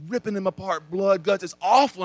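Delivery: ordinary talk, nothing marked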